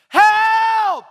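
high, held, full-force yell; shouting help. Acted. Whole world shouting to the church, full yell. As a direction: belt out a cry for help. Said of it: "The whole world is shouting help".

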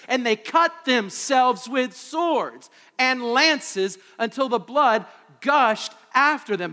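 heavy dramatic sermon; full-force preaching. Acted. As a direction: hammer out each word.

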